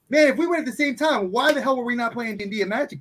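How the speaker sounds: high and fast, words tumbling out; excited. Real, not acted. Realizing they could have played D&D together, excited. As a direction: burst with excitement over lost years.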